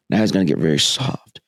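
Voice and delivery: normal talking voice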